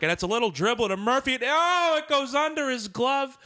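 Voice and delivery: excited play-by-play call climbing high; Howie Rose. Retold or imitated. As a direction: baseball radio announcer losing the play. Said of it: "you hear Howie Rose".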